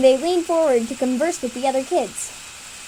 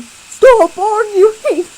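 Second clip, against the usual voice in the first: high, loud, slow shout; 8 semitones higher, 11 dB louder, much slower than usual. Acted. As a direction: yell to end the quarrel. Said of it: "was shouting".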